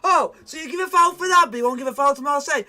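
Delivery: loud, fast outraged rant; angry complaint. Real, not acted. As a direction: rant at the biased ref. Complaining about ref decision.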